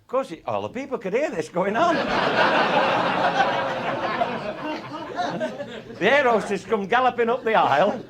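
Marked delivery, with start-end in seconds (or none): 2.0-5.9 loud high laugh tailing off; long high laugh. Real, not acted. Laughing at the pilot joke, long high laugh. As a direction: laugh hard then let it fall away.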